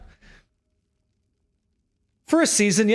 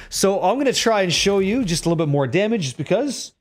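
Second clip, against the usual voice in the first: normal talking voice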